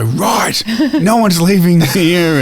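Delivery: slow, loud, high fuming teacher. Retold or imitated. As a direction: lay down the law to the class. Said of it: "they'd come back and go".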